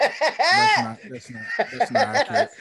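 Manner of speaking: high-pitched, laughing through the words; real laughter breaking into speech; laughing protest. Real, not acted. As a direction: object while cracking up. Laughing at friend's bad accent.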